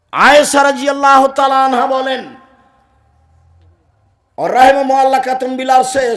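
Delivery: high, loud hadith proclamation; full-force preaching. Acted. (পূর্ণ শক্তিতে উচ্চস্বরে ওয়াজ)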